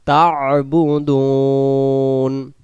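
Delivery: তা'বুদুন with the ain, slow and drawn; correct ta'budun. Shown as a demonstration. Teacher showing the right ain in tabudun, slow. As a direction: sound the ain in তা'বুদুন, stretch the end. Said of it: "এখানে উচ্চারণ হবে".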